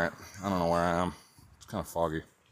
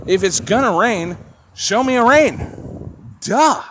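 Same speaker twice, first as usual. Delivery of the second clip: angry rant; 5 semitones higher, 4 dB louder than usual. Real, not acted. Ranting at weather app louder.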